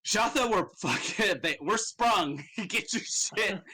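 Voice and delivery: giddy cackling escapee; laughing excitedly. Acted. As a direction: laugh through it, thrilled to be free. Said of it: "and he goes".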